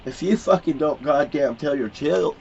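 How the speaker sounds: usual speaking voice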